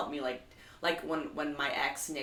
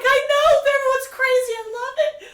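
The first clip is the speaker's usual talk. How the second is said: much higher and louder, laughing while crying; 14 dB louder than usual. Retold or imitated. Agreeing with the driver, laugh-crying, much higher and louder. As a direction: squeal it through happy tears. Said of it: "I'm, like, crying".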